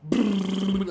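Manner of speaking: slow, loud drawn-out brrrr flush sound; mock flush noise; imitated flush. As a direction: rumble it out like a toilet flushing.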